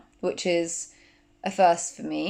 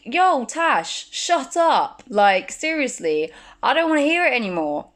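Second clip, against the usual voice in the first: higher, louder snap at her own nagging thoughts; telling herself off; 6 semitones higher, 5 dB louder than usual. Retold or imitated. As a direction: shout down the voice in your head. Yells back at her inner voice.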